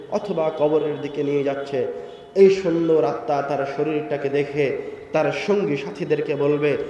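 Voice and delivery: ordinary talk, nothing marked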